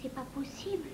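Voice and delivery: very soft, crushed murmur; stunned disbelief. Acted. Carmen learns she has not won, stunned and quiet. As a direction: take in the blow almost without voice.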